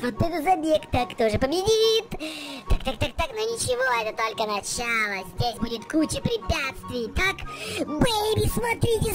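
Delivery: fast, high play-by-play; excited race commentary. Acted. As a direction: call the race live.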